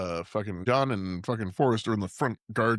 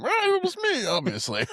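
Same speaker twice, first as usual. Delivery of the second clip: mock boast. Mocking the farmer's boast.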